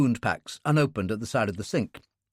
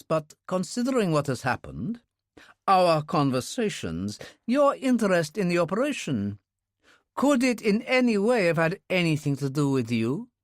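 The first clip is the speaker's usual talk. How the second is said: lightly raised, earnest friend's voice; Russian man voice; 6 semitones higher than usual. A narrator's reading. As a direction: voice him as a sincere old comrade. Bounine questions Alex.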